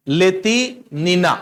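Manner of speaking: लेतिनिना slowly in two parts, ले-ति, then नि-ना; slow, split name. Shown as a demonstration. Teacher repeating the gymnast's name for students, slowly, in parts. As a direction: stretch लेतिनिना with a break in the middle.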